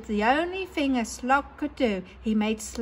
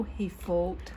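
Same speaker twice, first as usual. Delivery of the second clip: ordinary delivery, nothing marked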